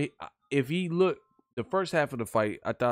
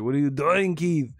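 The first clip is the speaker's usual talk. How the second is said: mock Arnold barking orders at Keith; Arnold impression; 4 semitones higher, 4 dB louder than usual. Streamer doing Arnold telling Keith to move, light Austrian accent. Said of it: "like Arnold".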